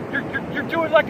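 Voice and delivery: normal talking voice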